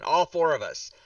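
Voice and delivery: ordinary delivery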